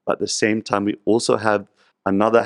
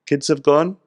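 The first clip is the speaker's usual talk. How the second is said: higher, louder hurrying sage; Narad Muni returns; 7 semitones higher, 4 dB louder, slower than usual. Retold or imitated. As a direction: chivvy him along cheerfully. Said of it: "And he says".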